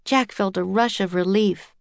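usual speaking voice

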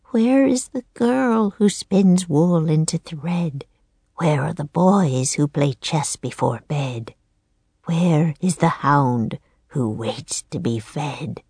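put-on slow, cracked old woman's chant. A narrator's reading. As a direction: recite a riddling rhyme in an old crone's voice. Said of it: "In a cracked voice".

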